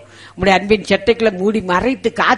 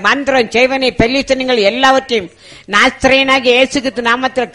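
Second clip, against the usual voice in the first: louder, higher pleading prayer at full force; fervent shouted prayer; 5 semitones higher, 7 dB louder than usual. Acted. Preacher calling on Jesus in prayer, loud and fervent. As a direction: cry out to God to touch the sick, building.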